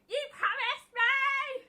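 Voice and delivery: high, loud, slow sing-song wail; wailing tantrum. Retold or imitated. Sister clinging to the porch rail, a wailing tantrum. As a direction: wail it like a toddler. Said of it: "kept saying".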